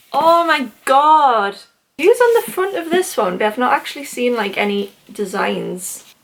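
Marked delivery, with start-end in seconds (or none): 0.1-3.4 awed surprise. Real, not acted. Unwrapping more special editions.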